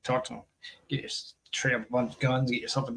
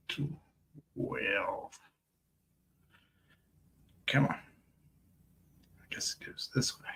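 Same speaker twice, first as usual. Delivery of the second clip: hushed whisper while working; quiet unvoiced aside; 10 dB quieter, much slower than usual. Real, not acted. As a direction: breathe it under your voice. Whispering while handling the coin.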